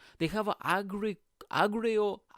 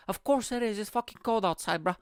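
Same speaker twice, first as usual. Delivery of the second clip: higher-pitched comic indignation; exasperated mock rant; 6 semitones higher than usual. Comic answering the nudist woman, in exasperated high-pitched rant. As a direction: protest the obvious, pitched up.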